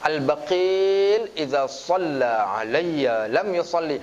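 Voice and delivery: slow, chanted Arabic recitation; hadith recitation. Acted. Preacher quoting the hadith to the congregation, slow chant. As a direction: recite the hadith slowly and reverently.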